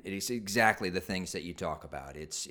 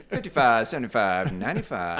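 mock auctioneer calling numbers; 3 semitones higher, 9 dB louder than usual. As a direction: rattle off bids like an auctioneer. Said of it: "sounded like an auctioneer there".

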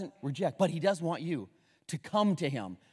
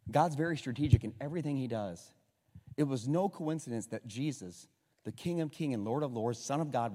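usual speaking voice

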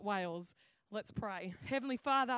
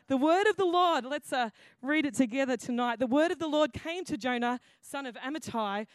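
louder, formal reading voice; scripture reading; 7 dB louder than usual. Acted. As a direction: proclaim it to the congregation. Announces and reads scripture.

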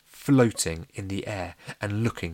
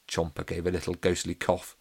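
usual speaking voice

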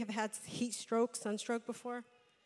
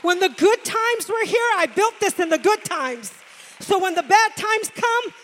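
much louder, much higher shouted declaration; defiant shout; 6 semitones higher, 17 dB louder than usual. Acted. Grieving mother declares her faith, shouting. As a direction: cry it out with fierce defiance. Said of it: "And she said".

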